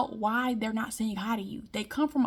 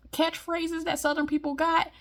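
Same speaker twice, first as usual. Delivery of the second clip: ordinary delivery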